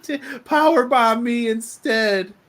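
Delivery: high, slow, drawn-out mock plea; mocking hero plea. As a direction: ham up a whiny hero plea. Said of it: "the dumbest babyface hero line ever".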